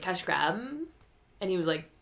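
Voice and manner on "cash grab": cash grab drawn out, rising; Valley Girl drawl. Retold or imitated. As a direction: stretch the words cash grab into a question. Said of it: "but she was like".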